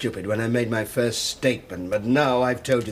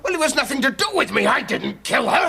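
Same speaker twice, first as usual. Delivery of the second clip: high, desperate outburst; panicked, cornered shouting; frantic denial; 13 semitones higher, 4 dB louder than usual. Acted. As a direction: protest your innocence at the top of your pitch. Accused suspect frantically denies the killing.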